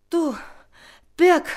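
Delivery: breaking the words up between breaths; gasping. A narrator's reading. Ronja finds Birk alive, gasping. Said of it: "keuchte Ronja".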